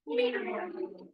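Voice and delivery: high mewing cat voice; cat meow. Acted. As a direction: mew it like a cat. Cat meows in story.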